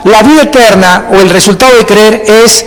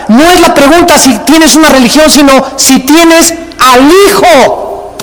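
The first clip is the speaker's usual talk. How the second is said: rising preaching; 5 semitones higher than usual. Acted. Pastor preaches at full force.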